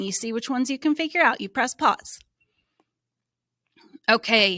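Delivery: ordinary talk, nothing marked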